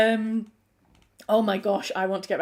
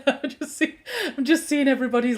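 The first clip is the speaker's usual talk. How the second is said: talking through laughter, slightly higher; laughing; 6 semitones higher, 5 dB louder than usual. Real, not acted. Seeing everybody's laughing faces, talking while laughing. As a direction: giggle while explaining.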